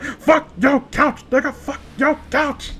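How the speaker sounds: slow, high, put-on goofy chant; mock couch-humper voice. Mocking the guys humping the couch, slow high goofy voice. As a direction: ham it up like a dumb guy mid-hump.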